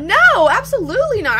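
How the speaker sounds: high, loud indignant no; outraged no; indignant refusal. Real, not acted. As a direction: shoot it down hard. Refusing to give up Alabama.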